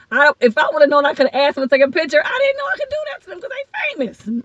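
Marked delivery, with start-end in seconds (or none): 2.2-4.4 very high, thin squealed lament; exasperated disbelief. Real, not acted. Lamenting missed photos with famous comics, squealed disbelief. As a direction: wail it comically over a missed chance. Bemoans missed chances with celebrities.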